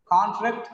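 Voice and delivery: full, stressed "con" opening "Contract"; stress on first syllable. Shown as a demonstration. Teacher contrasting word forms, "Contract" stressed on CON. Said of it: "the first syllable becomes con".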